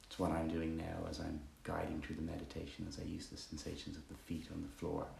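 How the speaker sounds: soft, unhurried meditation guidance; guided meditation. Acted. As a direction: guide them gently through body and breath, pausing between phrases.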